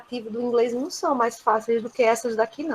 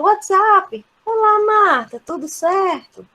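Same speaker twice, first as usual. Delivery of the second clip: high, loud, chummy hello; informal greeting; 8 semitones higher, 4 dB louder, slower than usual. Shown as a demonstration. Teacher acting out greeting Marta informally, casual and high. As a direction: hail a friend casually, drawing out the end. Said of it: "Como muda o tom da conversa".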